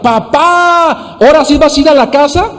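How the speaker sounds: high-pitched imitation of a child's yell; child calling out. Son calls to his father from afar, imitated high-pitched calling. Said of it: "desde lejos me grita".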